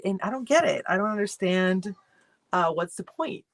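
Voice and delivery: normal talking voice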